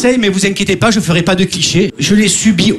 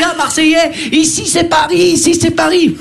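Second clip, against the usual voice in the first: high-pitched mock football taunt; taunting Paris fan; 7 semitones higher than usual. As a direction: imitate a Parisian crowing at a Marseillais. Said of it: "on me parle de foot à Paris". (supporter parisien moqueur, voix aiguë)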